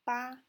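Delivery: high, level, loud "ba"; strong flat high tone on "ba"; first tone. Shown as a demonstration. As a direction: keep "ba" high and even. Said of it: "one, two, three".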